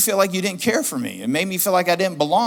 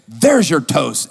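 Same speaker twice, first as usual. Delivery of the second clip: loud, drawn-out mock fury; retold angry outburst; 7 dB louder, much slower than usual. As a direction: throw it out loud and slow. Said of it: "I started getting angry".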